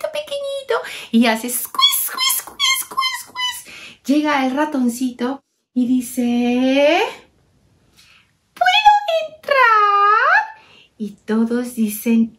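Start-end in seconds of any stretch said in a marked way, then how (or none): 0.1-0.7 very high and soft; squeaky little word; tiny voice. Acted. Describing a tiny animal. 1.5-4.0 extremely high, soft, fading squeaks; mouse squeaks. Acted. Mouse sound. 8.5-10.6 very high, slow, timid mouse; squeaky mouse pleading to enter; little mouse voice. Acted. Mouse asks to come in.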